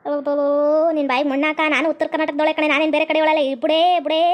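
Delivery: put-on low, gruff old village woman; old mother-in-law. Old mother-in-law waving off the wife, low and gruff.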